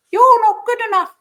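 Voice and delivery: very high, loud squeaky puppet voice; squeaky cartoon voice. Shown as a demonstration. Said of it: "I do it in that voice".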